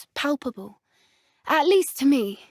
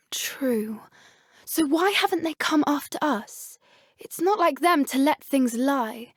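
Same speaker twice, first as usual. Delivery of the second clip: ordinary talk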